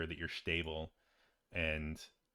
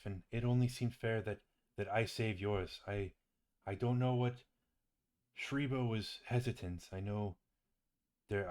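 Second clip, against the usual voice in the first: earnest, humble Droop. Acted. Droop tells the waking friend why he helped, earnest and halting. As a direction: haltingly explain a debt of gratitude. Game master voices Droop at the bedside.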